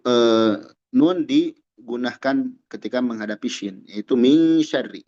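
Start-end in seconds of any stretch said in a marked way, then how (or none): 4.2-5.0 "min syarri" with n hidden and held; ikhfa. Shown as a demonstration. Teacher illustrating ikhfa, "min syarri" with held nasal. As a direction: hum through "min" into "syarri". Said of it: "karena dia ikhfa".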